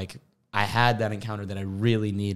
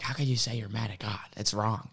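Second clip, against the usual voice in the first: a light, mocking take on an indignant commenter, a bit softer; mocking angry commenter; 5 dB quieter than usual. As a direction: parrot the outraged comments back. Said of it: "some people were really angry at me in my comments".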